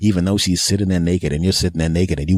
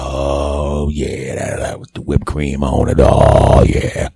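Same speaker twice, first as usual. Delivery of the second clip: mock lustful moan; 5 semitones lower, slower than usual. Lustful moaning over whipped cream.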